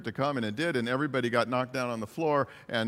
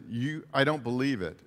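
ordinary talk, nothing marked

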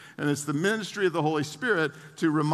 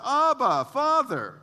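higher, louder cry of Abba, Father; calling out; 9 semitones higher, 5 dB louder, slower than usual. Retold or imitated. A child's spirit calling out to the Father, raised. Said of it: "the Spirit who calls out".

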